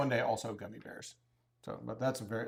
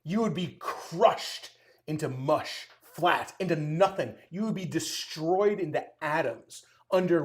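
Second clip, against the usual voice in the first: louder, higher hammed-up fury; mock aggressive rant; 4 semitones higher, 10 dB louder, slower than usual. Imagined reply to a doubting viewer, mock furious rant. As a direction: rant like a biker insulted to the core. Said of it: "kind of aggressive".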